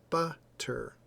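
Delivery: "Butter" split in two, emphasis on the first syllable; stressed first half of "Butter", weaker second half. Shown as a demonstration. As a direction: sound out "Butter" with a pause, letting the second syllable drop. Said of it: "our tone of voice is going up".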